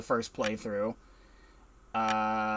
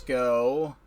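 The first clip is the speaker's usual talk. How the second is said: ordinary talk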